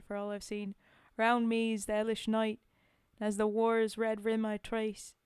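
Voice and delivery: a put-on British soldier's accent for the poem. Reciting a soldier's war poem aloud, in a put-on accent. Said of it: "back-to-back accents".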